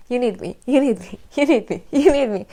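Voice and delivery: insistent demands. Retold or imitated. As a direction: imitate needy kids piling on demands. Children demanding her nonstop.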